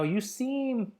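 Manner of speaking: a lightly lifted opener for a label. Shown as a demonstration. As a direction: start the label up high and gentle. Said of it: "just call it out".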